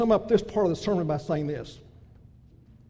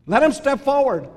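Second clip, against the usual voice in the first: louder, higher call to come forward; defiant challenge; 4 semitones higher, 6 dB louder than usual. Real, not acted. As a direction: dare them to step up.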